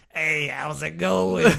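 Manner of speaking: slow, drawn-out goofy greeting; silly put-on voice. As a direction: stretch it in a silly voice. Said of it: "if I spoke like this".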